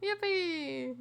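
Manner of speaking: high loud laughing yay; excited laughing cheer. Real, not acted. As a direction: cheer with delight. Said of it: "I'm gonna cry".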